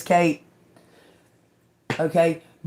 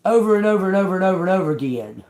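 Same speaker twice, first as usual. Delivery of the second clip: rattled off fast and louder; fast exasperated run; 4 dB louder, much faster than usual. Real, not acted. Tired of hearing the same complaints, fast exasperated list. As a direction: pile up the repeats in frustration.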